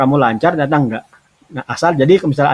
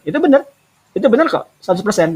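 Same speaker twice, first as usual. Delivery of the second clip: excited approval; 6 semitones higher than usual. Real, not acted. Teacher approving translated example.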